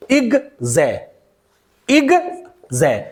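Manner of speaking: "इग जै" as two separate syllables, ig, zai; split into syllables. Shown as a demonstration. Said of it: "दो syllable में बांट दिया गया". ("इग जै" दो अलग सिलेबल में, रुक-रुक कर)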